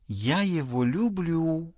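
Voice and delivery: soft, slow, bashful declaration of love; a blushing girl, eyes down, barely above a murmur. Acted. Daughter to her father, softly and bashfully. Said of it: "зардевшись и потупив глаза".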